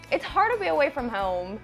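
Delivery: ordinary talk, nothing marked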